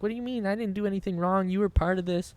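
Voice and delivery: high-pitched indignant protest, retold; defensive. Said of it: "like, defensive".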